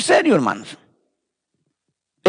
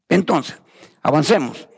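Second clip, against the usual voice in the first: ordinary talk, nothing marked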